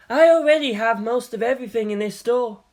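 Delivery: lightly voiced dry young man. Makoto answers owner.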